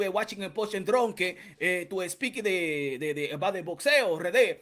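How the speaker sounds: a hint of a Dominican accent, halting on "eh". Mocking how a Dominican speaker talks English, with a light Dominican accent. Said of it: "he always say".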